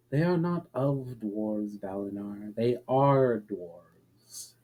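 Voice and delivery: a hint of a patient elf correcting a guest; lightly voiced elf Alira. A narrator's reading. Alira corrects puzzled Valinar, slow and softly deliberate.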